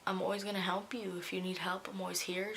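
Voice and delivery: soft, tender retold mom; gentle reassurance. Mother offering help after the news, gently reassuring. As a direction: reassure quietly, without pushing. Mother comforting her in retelling.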